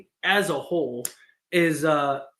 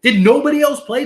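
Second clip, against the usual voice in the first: louder, higher, fed-up question; exasperated rant; 5 semitones higher, 7 dB louder than usual. Real, not acted. Ranting at Lakers haters, loud exasperated question. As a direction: throw it at the haters in disbelief.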